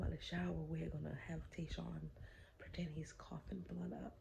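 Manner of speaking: secret hushed whisper. Real, not acted. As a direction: keep it to a whisper. Whispering the prank plan.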